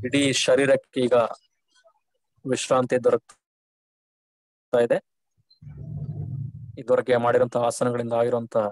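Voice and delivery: calm shavasana guidance; guided relaxation. Real, not acted. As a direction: ease them into complete rest, unhurried, letting phrases sink away. Guides the class through final relaxation.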